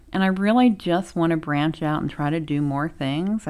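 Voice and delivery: ordinary delivery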